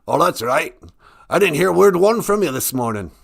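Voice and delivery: high, loud sarcastic reproach. Acted. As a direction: chide him for forgetting to pray. Voices higher power scolding him.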